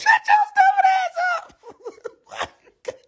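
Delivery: shrieked high-pitched put-down; high mock shout. Comic telling off rappers, high mock shout. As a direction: yell it way up high in mock outrage. Yelling at the nail guy.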